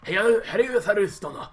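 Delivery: hammed-up Japanese accent, playful. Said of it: "Japanese accent".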